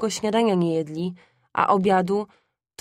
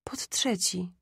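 soft, whispery echo; sick mother repeating faintly; weak repetition; 6 dB quieter, slower than usual. A narrator's reading. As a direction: confirm it under the breath, much softer. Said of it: "powtórzyła matka".

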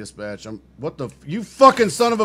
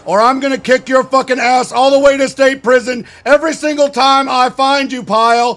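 barking drill instructor tearing into a recruit; drill sergeant; 6 semitones higher, 5 dB louder than usual. Acted. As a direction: berate him like a Marine drill sergeant.

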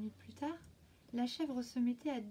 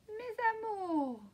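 higher, very slow honeyed wolf; sweet wolf voice; 10 semitones higher, 4 dB louder, slower than usual. A narrator's reading. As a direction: coax the kids to open up. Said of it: "dit-il".

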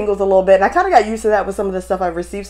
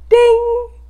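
high, loud imitated bell ding; playful high-pitched "ding"; 5 dB louder than usual. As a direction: chime it like a notification bell. Imitates a bell after "press it.

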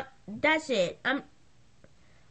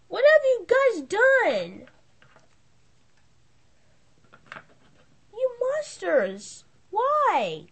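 high, slow, wounded reproach; dismayed reproach; 7 semitones higher, 4 dB louder, slower than usual. Acted. Owner scolding the players over the dead chihuahua, appalled. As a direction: drag it out, appalled.

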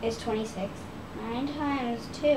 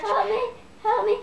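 panicked cry for help; 10 semitones higher, 4 dB louder than usual. Real, not acted. Under attack by spider.

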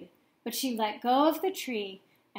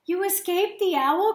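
higher and louder, overjoyed; excited cry; 8 semitones higher, 7 dB louder than usual. A narrator's reading. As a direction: call out in happy disbelief. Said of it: "cried Stella Luna".

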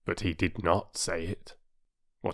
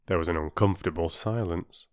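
usual speaking voice

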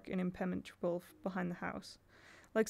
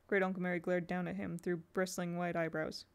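usual speaking voice

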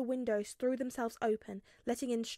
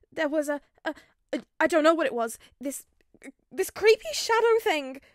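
high, loud, panicked stammer; frightened stammer; 6 semitones higher, 11 dB louder than usual. A narrator's reading. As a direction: stumble over the words in fear. Ned frightened by shadow.

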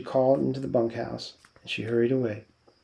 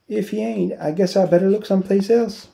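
playful; 8 semitones higher, 4 dB louder than usual. A narrator's reading. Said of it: "she said playfully".

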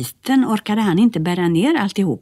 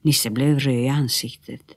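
ordinary delivery

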